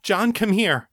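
high, urgent cry for help; panicked call. A narrator's reading. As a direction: call him over in sheer panic. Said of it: "from muzzy confusion to panic".

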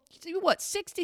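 ordinary talk

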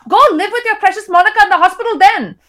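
put-on high, loud drunken wife's scream in an Indian accent. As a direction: scream it at your husband like a soap opera star.

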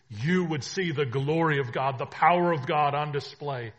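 loud ringing proclamation; preaching at full force. Acted. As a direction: declare it to the whole room. Preaching climax.

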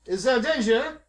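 high, loud alarmed question; alarmed Jacques. Acted. Jacques answering Adelaide's order to flee, high alarmed question. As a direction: ask it high, playing up the alarm.

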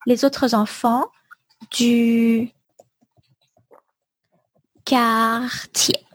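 slow, spaced dictation; dictation pace. Shown as a demonstration. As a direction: read each word slowly for dictation. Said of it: "dictation of last phrase".

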